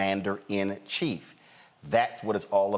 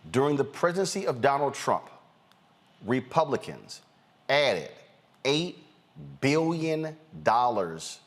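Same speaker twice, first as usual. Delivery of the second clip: spaced out with heavy pauses; deliberately slow; 3 semitones higher than usual. Shown as a demonstration. Host spelling out a point for folks, slow and deliberate. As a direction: lay it out slowly, phrase by phrase. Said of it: "let me say this slowly".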